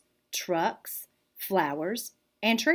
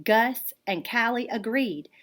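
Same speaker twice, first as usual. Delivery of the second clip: usual speaking voice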